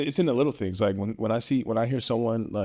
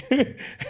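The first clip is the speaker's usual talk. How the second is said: laugh. Real, not acted. Laughs mid-sentence about Dems.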